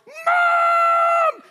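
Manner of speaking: long, very high, loud holler; tattling yell. Retold or imitated. Kicked brother calling his mother to tattle, hammed-up yell.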